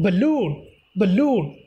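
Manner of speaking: balloon as buh-LOON, LOON stressed; stress on second syllable. Shown as a demonstration. Teacher modelling word stress for students, balloon stressed on -LOON. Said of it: "second syllable go to the str".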